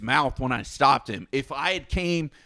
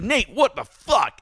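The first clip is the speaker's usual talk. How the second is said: higher, slow angry yell at Nate; retold yell; 8 semitones higher, 4 dB louder, slower than usual. Yelling at Nate over the furniture, slow angry yell. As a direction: bark it out slowly. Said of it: "I yelled at Nate".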